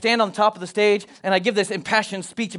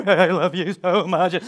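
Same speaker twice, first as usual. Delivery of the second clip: quivery voice. Retold or imitated. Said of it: "you got the quivery voice".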